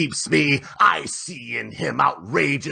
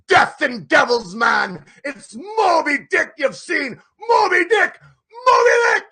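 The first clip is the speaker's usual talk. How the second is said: rising from loud cry to a much higher, louder shout; building shout; 7 dB louder than usual. Acted. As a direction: let it explode into a roar of the name. Ahab realises it's Moby Dick.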